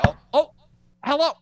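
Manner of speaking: jumps up high, caught off guard; startled hello. Real, not acted. Therapist caught mid-rant greets his arriving client, startled. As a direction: switch to a bright, startled greeting.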